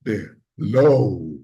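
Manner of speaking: low pitch demo. Shown as a demonstration. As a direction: drop it very low. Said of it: "We have three levels".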